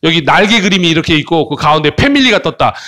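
ordinary talk, nothing marked

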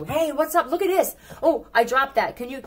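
high, bright-pitched fake friendly; mock distractor. Reader playing a scammer distracting you, high and friendly. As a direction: sweeten it to pull attention away.

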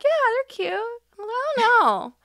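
very high, vague brush-off; noncommittal high voice. Retold or imitated. Own noncommittal reply, very high.

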